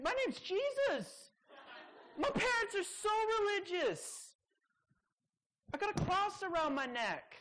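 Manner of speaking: high, laughing, bragging mock voice; mock boastful believer. Preacher mocking a bragging believer, high and boastful. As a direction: brag like a self-righteous believer.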